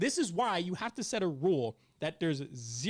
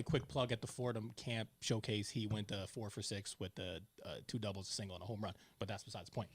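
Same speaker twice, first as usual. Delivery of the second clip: soft, low throwaway plug; muttered side note about the showcase; quiet aside; 9 semitones lower, 9 dB quieter than usual. Real, not acted. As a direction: drop the voice for a quick brag about his son. Quick aside plug for son.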